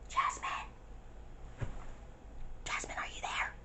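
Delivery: soft whispered calling of a name; hushed call to a friend inside. Retold or imitated. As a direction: whisper her name through the blinds. Calling softly through the window.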